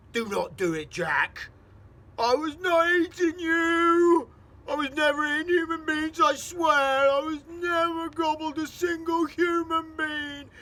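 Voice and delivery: slow, loud, very high desperate protest; pleading giant. Acted. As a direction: swear innocence in terror.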